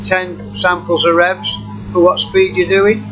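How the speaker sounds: ordinary delivery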